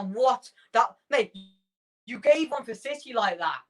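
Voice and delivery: what was that, ref, come on; incredulous protest. Real, not acted. Protests a missed foul call.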